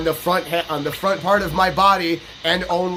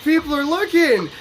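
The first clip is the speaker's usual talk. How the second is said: mock-thrilled self-admiration; 8 semitones higher, slower than usual. Mock admiring himself in uniform.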